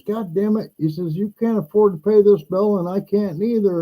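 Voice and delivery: lightly voiced landlord losing it over the bill; landlord freaking out. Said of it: "my landlord's freaking out".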